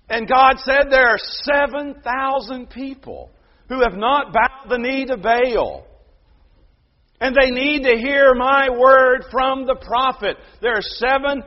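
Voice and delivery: loud, high, full-force sermon voice; fiery preaching. Acted. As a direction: drive every point home from the pulpit.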